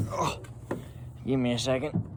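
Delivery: usual speaking voice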